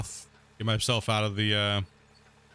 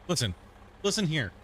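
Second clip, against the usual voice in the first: ordinary delivery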